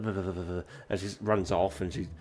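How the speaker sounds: ordinary talk, nothing marked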